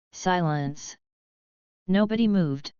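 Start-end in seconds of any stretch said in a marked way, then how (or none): none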